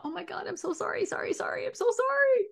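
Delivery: very high, squeaky panicked sorries; frantic apology. Retold or imitated. Apologizing to her husband, a very high frantic squeal. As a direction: squeal the apology in a flustered rush. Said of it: "not from a".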